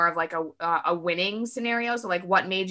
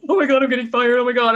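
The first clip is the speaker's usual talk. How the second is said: loud, high mock panic; panicked spiral; 4 semitones higher, 9 dB louder, faster than usual. Anxious worker reading a client email, mock panic. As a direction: blurt it like you're about to be fired. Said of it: "I spiral like freaking mad".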